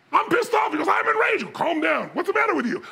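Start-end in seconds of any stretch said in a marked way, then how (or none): 0.1-0.7 high-pitched indignant outburst; hammed-up pissed-off exclamation; acted anger. Acting out his own anger. 0.9-1.5 very high mock-furious exclamation; acted rage, pitched way up; imitated enraged partner. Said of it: "he's so much more intense". 1.5-2.8 higher, mock-impatient telling-off; acted exasperation, pitched up; exasperated scolding. Acted scolding of the enraged friend.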